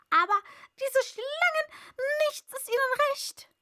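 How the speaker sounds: high, loud bird voice, ranting; complaining pigeon. A narrator's reading. Pigeon ranting to Alice, high and loud. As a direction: rattle off the grievances, shrill bird.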